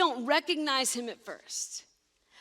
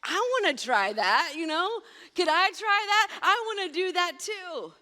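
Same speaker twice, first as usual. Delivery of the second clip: eager dude; 5 semitones higher, 3 dB louder than usual. Retold or imitated. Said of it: "There's a dude in the boat that's like".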